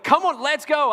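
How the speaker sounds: high, loud urging shout; dad hurrying kids out; urging call. Retold or imitated.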